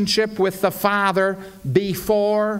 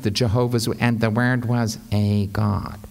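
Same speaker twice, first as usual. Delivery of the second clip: low, deflated reading with no lift on God; drab, lowered delivery with no climax; anemic, anticlimactic; 6 semitones lower than usual. Shown as a demonstration. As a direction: let "a God" sink limply at the end. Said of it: "see how anemic and anticlimactic".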